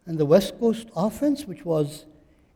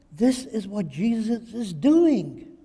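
ordinary talk, nothing marked

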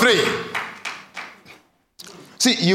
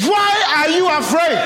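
high, raised, insistent question; Jesus asking; 8 semitones higher, 3 dB louder than usual. Acted. Preacher voicing Jesus to the congregation, high and insistent. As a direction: press the question hard. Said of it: "Jesus is saying".

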